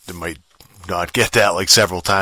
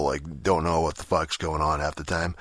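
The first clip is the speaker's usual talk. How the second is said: ordinary talk, nothing marked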